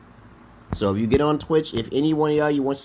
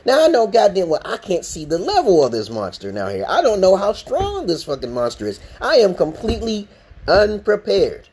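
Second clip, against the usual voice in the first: ordinary delivery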